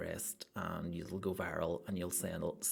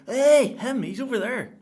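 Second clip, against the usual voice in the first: loud, high excited yell; excited shout; 14 dB louder than usual. Retold or imitated. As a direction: point and shout across the crowd. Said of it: "people are gonna point to me".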